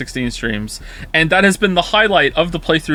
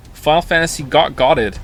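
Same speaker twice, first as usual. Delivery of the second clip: ordinary talk, nothing marked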